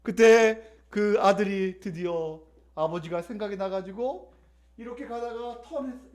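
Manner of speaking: usual speaking voice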